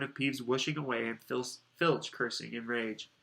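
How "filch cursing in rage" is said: ordinary delivery, nothing marked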